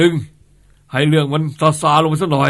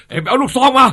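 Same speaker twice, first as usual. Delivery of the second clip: higher, louder urgent command; urgent order; 10 semitones higher, 6 dB louder than usual. Acted. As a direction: bark the order for the gun. Boss orders the shotgun.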